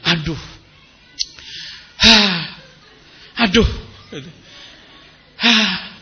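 exaggerated sighing complaint; imitated exasperated groaning; mock complaining. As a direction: huff and moan like a habitual complainer. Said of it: "diselesaikan dengan ngeluh".

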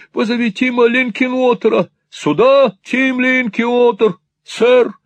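high, loud calling out; urgent exclamation. A narrator's reading. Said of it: "воскликнул тот".

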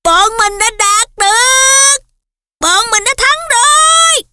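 high, slow ecstatic yell. A narrator's reading. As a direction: bellow the victory with joy. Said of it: "Nó hét tướng lên".

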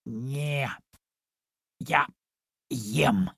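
word by word, with long gaps; very serious. A narrator's reading. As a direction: answer gravely as a solemn kitten. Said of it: "очень серьёзно ответил". (очень серьёзно, с расстановкой)

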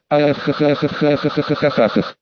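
ringing evil cackle; villainous laugh. A narrator's reading. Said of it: "злодейски засмеялся".

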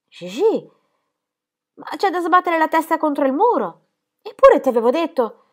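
più acuta e forte, disperata; rimprovero esasperato. A narrator's reading. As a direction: sbattere la testa contro il muro. La nonna rimprovera Gigi esasperata. (nonna che rimprovera la nipote, esasperata)

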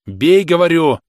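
high, very loud command; shouted order. A narrator's reading. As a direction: bellow the order at him, out of patience. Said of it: "крикнул Становой". (крик, яростный приказ)

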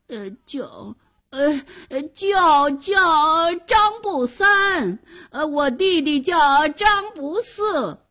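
hesitant, then high drawn-out wail; stammering rogue. Acted. Drowning man making up a name, stammering strained cry.

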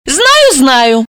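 high, quick agreement; chiming in. A narrator's reading. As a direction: chime in knowingly. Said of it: "подхватила Алиса".

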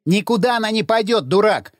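indignant outburst, not full shout; exclaiming. A narrator's reading. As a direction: snap back in protest. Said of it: "воскликнул Павел".

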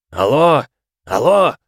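loud, high blurted shout; shouting into the phone. Acted. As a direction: bellow it down the line. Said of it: "выпалил прямо в микрофон".

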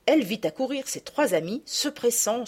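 usual speaking voice

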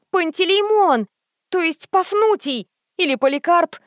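high, loud panicked scream. A narrator's reading. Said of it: "заорала".

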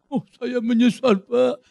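slow, drawn-out mock confession; hammed-up penitent prisoner; mock remorseful inmate. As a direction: drag out a fake plea of regret. Mimics a prisoner's tearful regret.

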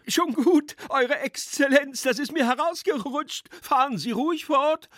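put-on very high-pitched drunken youth; heckler's voice. A narrator's reading. Drunk schoolboy waves the speaker on, high heckling. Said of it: "erklang die Stimme erneut".

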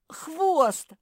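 high, loud howl; drawn-out yelping cry. A narrator's reading. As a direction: howl it out in pain. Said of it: "взвыл Банди".

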